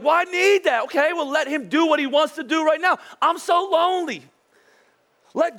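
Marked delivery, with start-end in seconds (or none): 0.0-0.8 high, whiny mock plea; a whiny parody of a lonely single, pitched up. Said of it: "some of you are like". 3.2-4.2 high, whiny mock lament; mock lonely whine. Mocking lonely single.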